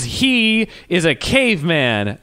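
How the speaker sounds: slow, high mock proclamation; drawn-out dramatic reveal, imitating a stage orator; rousing speech. Retelling the villain's big reveal at prom, mock rousing. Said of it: "gives this rousing speech".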